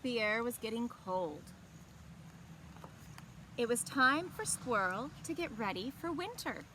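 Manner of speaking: gentle, expressive storybook reading; picture-book narration to young listeners; reading to children. A narrator's reading. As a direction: read it like story time for kids. Library story time, reading aloud.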